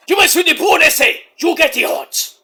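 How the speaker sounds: high, put-on Mexican accent; mock Mexican accent. Host warning as a wrestler, high mock Mexican accent. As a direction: threaten in a hammed-up Mexican accent. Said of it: "my Mexican impression is bad".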